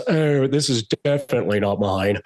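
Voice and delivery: drawling movie-star impression; deadpan eccentric star, words stretched; Nicolas Cage. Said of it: "and he goes".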